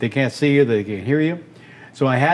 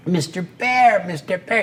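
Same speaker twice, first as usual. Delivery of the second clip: lightly voiced duck that doesn't land yet; boy's early attempt at a duck voice; failed duck voice attempt; 4 semitones higher than usual. Shown as a demonstration. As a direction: try for the duck and miss. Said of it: "I tried and tried".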